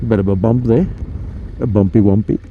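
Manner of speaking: ordinary talk, nothing marked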